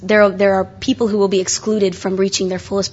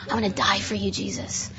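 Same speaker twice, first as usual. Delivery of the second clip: hushed, breathy devotion to Jesus; soft, lovesick prayer under the breath; tender whisper; 11 dB quieter than usual. Retold or imitated. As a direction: whisper it like a love confession. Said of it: "I was all tender".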